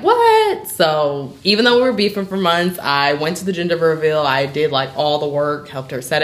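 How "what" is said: high stunned what; shocked. Retold or imitated. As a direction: blurt it out in disbelief. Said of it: "And I'm like".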